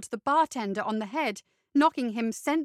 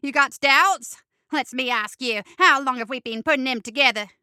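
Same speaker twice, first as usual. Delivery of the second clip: pushy goblin; 8 dB louder than usual. Acted. Ray presses doubtful Bo.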